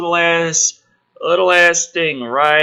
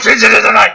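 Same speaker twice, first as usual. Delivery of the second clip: loud laugh at the joke; burst of laughter; 7 semitones higher, 10 dB louder than usual. Real, not acted. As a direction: laugh out at your own bit. Laughing at own impression.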